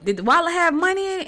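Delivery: high, loud mock-incredulous question; incredulous mother. Mom questions daughter about the returned wallet, incredulous. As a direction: imitate mom checking in disbelief.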